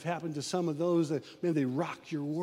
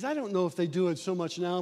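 usual speaking voice

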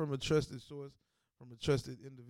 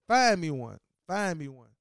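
higher, louder daring demand; heated challenge; 9 semitones higher, 5 dB louder than usual. Real, not acted. Host daring the team to find another Lamar, heated. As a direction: dare them to find another one.

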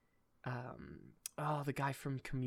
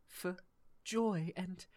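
put-on higher, earnest British accent; hopeful British speech; 7 semitones higher than usual. Promising joy to the world in a hopeful British accent. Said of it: "whenever I'm giving a hopeful speech, I go British".

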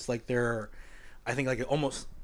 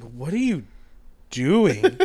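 alarmed coach; 3 semitones higher, 7 dB louder, much slower than usual. Retold or imitated. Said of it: "I'm just like".

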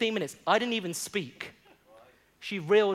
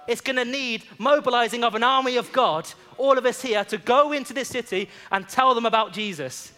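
louder, rousing sermon delivery; preaching at full force; 4 semitones higher, 6 dB louder than usual. Acted. Pastor rallying the congregation to evangelize, rousing preaching. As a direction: stir the church to go and witness.

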